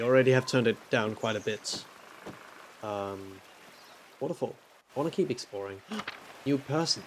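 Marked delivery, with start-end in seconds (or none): 5.8-6.4 sharp breathless gasp; surprised gasp. Real, not acted. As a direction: gasp at the sight. Spotting a new person.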